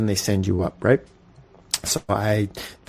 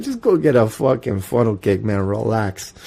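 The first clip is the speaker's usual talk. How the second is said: ordinary talk, nothing marked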